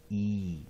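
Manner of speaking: E held as a long vowel. Shown as a demonstration. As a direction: draw out the E sound. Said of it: "Long vowel E". (E 发成拉长的长母音)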